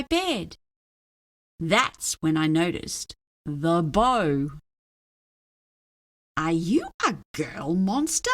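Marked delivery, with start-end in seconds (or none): none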